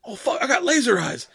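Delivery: high-pitched, hammed-up crowing; mock excited gloating. Kano taunting over new laser eyes, mock excited gloating. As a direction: imitate a cocky fighter thrilled with himself, pitch up.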